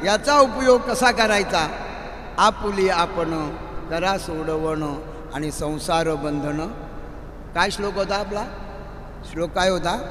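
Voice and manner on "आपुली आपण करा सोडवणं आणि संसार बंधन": slow emphasis. Acted. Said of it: "slow preaching emphasis".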